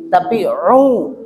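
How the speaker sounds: ru stretched out slowly; slow demo. Shown as a demonstration. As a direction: drag out ru. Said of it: "Jadi bukan u".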